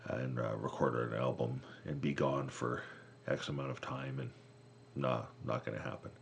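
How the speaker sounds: low, soft offhand refusal; mock refusal. Drummer backs out of recording trip, low mock refusal.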